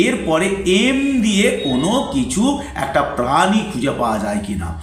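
usual speaking voice

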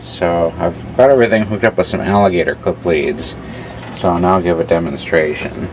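ordinary talk, nothing marked

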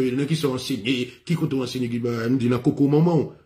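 ordinary delivery

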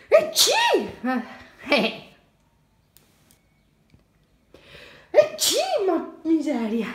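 high, loud pretend sneeze; fake sneeze. Shown as a demonstration. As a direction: act out a sneeze for the child. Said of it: "la mamma deve starnutire".

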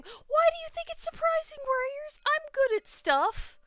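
high, loud offended outburst; indignant protest. Real, not acted. Player defends her skill to doubting friend, indignant. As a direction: protest loudly, pitch way up.

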